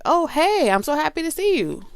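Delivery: high, loud, delighted hello; excited greeting. Retold or imitated. Coffee teller greeting a familiar face, high excited gush. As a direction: gush like you're thrilled to see them.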